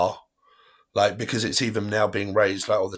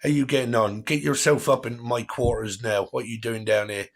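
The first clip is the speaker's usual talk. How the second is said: jokey West Country yokel greeting a lover; playful West Country accent, lightly hammed up; 2 semitones higher than usual. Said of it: "that kind of accent where it's like".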